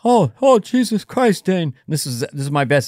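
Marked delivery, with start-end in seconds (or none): 0.0-1.8 higher, louder exasperated dad; mock the dad's fuss. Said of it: "This is how we talk".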